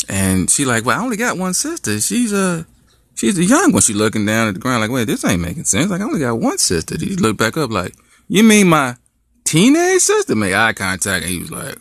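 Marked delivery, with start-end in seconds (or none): 0.9-10.3 high, rising disbelief; high bewildered girlfriend; confused girlfriend. Acted. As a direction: puzzle it out high and shocked. Girlfriend processing betrayal.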